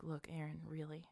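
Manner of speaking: hushed, wounded appeal; a soft, nearly whispered reproach from a shamed man; upset and ashamed. Acted. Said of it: "He's very upset".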